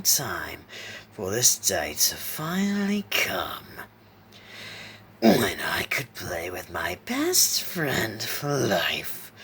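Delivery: slow, whispery rasp swooping up and down; raspy fiendish clown. A narrator's reading. Clown taunts his old friend Isaac, raspy menace. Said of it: "with a bone-chilling, raspy voice".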